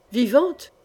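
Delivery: mildly exclaimed, stunned question; a reader's restrained cry of disbelief; understated shocked outcry, rising at the end; stunned yell. A narrator's reading. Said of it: "hurla le chevalier chancelant".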